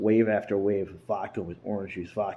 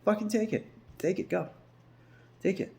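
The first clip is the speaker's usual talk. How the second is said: sarcastic shout, much higher; mocking higher yell; 13 semitones higher than usual. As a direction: fling it at him, higher and mocking. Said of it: "I said".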